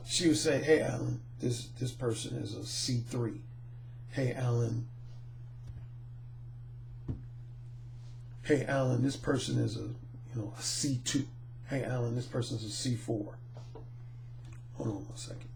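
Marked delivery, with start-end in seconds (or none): 0.6-13.4 hushed, low voice, much softer than usual; retold whisper. Coworker quietly tipping off Alan about a client's credit, hushed. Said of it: "she would whisper it to me". 14.7-15.5 real whisper, breath only; a quick hushed aside off-mic. Real, not acted. Speaker pausing the story for a moment, whispered.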